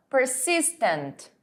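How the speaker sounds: Persistent said slowly, syllable by syllable, in English; slow model word. Shown as a demonstration. Teacher modelling Persistent slowly for students to repeat. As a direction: spell out Persistent slowly for the class. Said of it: "repitam comigo".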